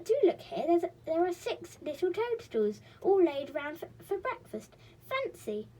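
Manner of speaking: high and loud, delighted; little girl thrilled; excited girl. A narrator's reading. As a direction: show off a discovery. Girl character exclaims.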